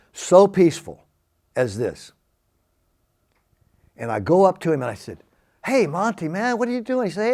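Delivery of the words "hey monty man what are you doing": much higher, bright surprised hello; excited greeting. Retold or imitated. Greeting his hometown friend on the street, higher and excited. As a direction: call out to an old friend in amazement.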